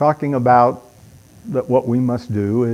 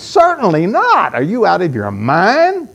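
indignant retort; 4 semitones higher, 4 dB louder than usual. Shown as a demonstration. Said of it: "both of these".

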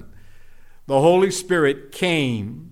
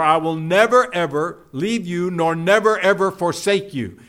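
"never" and "ever" punched louder each time; emphatic never ever; 3 dB louder than usual. Shown as a demonstration. Preacher hammering the promise, each "never" and "ever" said louder.

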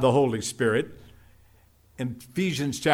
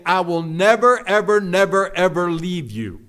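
"never" and "ever" punched louder each time; emphatic never ever; 2 semitones higher, 4 dB louder than usual. Shown as a demonstration. Preacher hammering the promise, each "never" and "ever" said louder. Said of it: "It's something like saying".

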